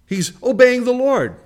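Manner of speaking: loud, high-pitched sermon build; full-force pulpit preaching; fiery preaching. Real, not acted. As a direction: preach it hard to the congregation.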